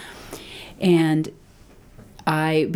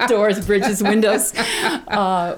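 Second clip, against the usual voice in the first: laughing; 6 semitones higher, 5 dB louder, much slower than usual. Real, not acted. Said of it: "You get an A plus today in metaphors".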